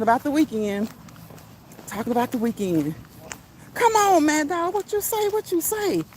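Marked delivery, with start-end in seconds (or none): 3.7-6.0 excited cheer. Real, not acted. Reacting to a viewer's score.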